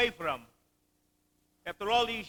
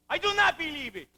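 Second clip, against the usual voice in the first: higher-pitched, pleading protest that breaks out; anguished outcry; 9 semitones higher, 3 dB louder than usual. Acted. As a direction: push it out in disbelief. Fiancé repeats his protest.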